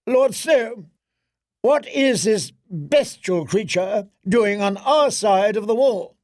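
high, imperious royal voice; haughty queen voice. Acted. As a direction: look down on everyone as queen.

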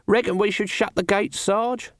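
high-pitched character voice, rising question; Nobby the guard. A narrator's reading. Nobby asking Sarge about shutting the gates, in a high voice. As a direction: pipe up to your superior.

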